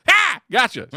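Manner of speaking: sudden loud high yell; mock gotcha shout. Driver playing a prank on passenger, loud. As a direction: jump out and scare him. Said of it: "They go".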